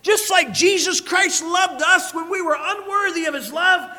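loud, high, full-force preaching. Acted. Preacher proclaiming God's glory to the church, full-force preaching. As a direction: thunder it out to the congregation.

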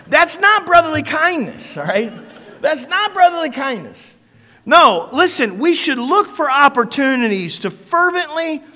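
loud, high, full-force preaching. Acted. Preacher proclaiming God's glory to the church, full-force preaching. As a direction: thunder it out to the congregation.

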